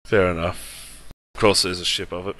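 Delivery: ordinary delivery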